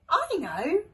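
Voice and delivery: high, loud eager call; excited cry. Acted. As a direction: blurt out the idea. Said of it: "cried the sausage dog".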